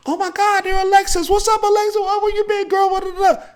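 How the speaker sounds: very high, loud, thrilled; squealing high happy hello; excited greeting. Retold or imitated. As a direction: greet her like a long-lost friend. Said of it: "What she did by just coming".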